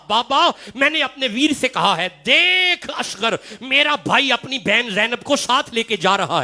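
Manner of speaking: lamenting recital. Acted. Grieving dialogue told in rising lament.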